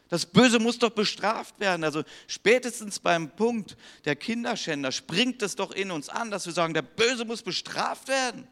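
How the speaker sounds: high, loud fervent preaching; preaching at full force. Acted. Preacher urging the congregation to find Jesus, fervent preaching. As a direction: proclaim it to the whole congregation.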